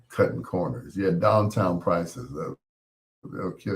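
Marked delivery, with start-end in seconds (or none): none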